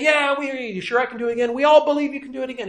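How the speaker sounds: high, quick, eager reassurance; eager crowd. Retold or imitated. Crowd assures the tightrope walker, high, fast and eager. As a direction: gush like a crowd that's all in. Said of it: "And they all say".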